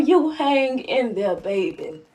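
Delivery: slow, softening, low reassurance; tender, unhurried words of encouragement; loving grandma. Acted. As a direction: comfort a grandson, sinking low and soft. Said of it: "Grandmas write their grandsons letters of love".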